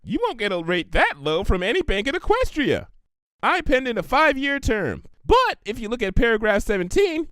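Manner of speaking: high, bubbly pony voice; Pinkie Pie voice. Acted. Pinkie offering Applejack the money, high and eager. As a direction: chirp it out eager and helpful.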